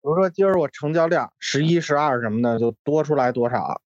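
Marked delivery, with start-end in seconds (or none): none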